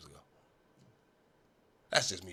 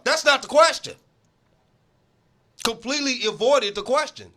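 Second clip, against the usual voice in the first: loud, higher, fed-up protest; exasperated outburst; 8 semitones higher, 7 dB louder than usual. Acted. Host scolding Brady for dodging the question, loud and fed up. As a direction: throw your hands up at the non-answer.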